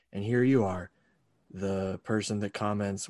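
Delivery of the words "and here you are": usual speaking voice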